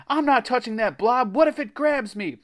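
high, loud panicked refusal; shouted refusal. A narrator's reading. Jack backs away from the blob, shouted refusal. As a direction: balk at the blob, voice pitched high. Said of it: "he shouted".

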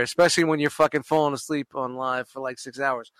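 ordinary talk, nothing marked

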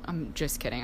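ordinary delivery

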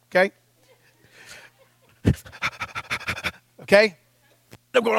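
ordinary delivery

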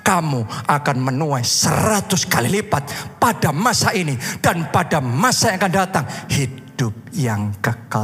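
low, measured, slow proclamation; deep-pitched, deliberate preacher's cadence; solemn scripture recital. Retold or imitated. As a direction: deliver the promise with weighty pauses. Solemnly voices the scripture promise.

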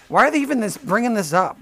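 ordinary delivery

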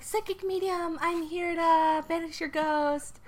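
high, slow chirpy psychic voice; high cheery psychic at the door; Sally voice. Acted. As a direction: announce yourself brightly at the door.

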